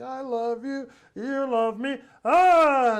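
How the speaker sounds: lame kiddie-show dinosaur with a sudden roar; cheesy friendly dinosaur. Retold or imitated. Dad doing Daddy Dinosaur for his little kids, goofy voice then loud roar. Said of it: "do Daddy Dinosaur".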